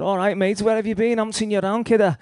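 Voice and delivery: put-on high and loud, hearty old mate's hello; loud, high-pitched cheery greeting from a rough old friend. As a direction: call it out high and loud like spotting an old mate in the street. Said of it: "Like that, you know".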